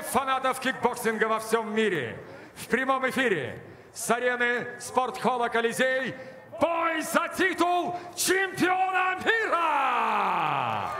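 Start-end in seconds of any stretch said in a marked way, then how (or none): 2.5-10.9 rising drawn-out shout; arena announcer. Real, not acted. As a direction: build to the title call. Title bout hype.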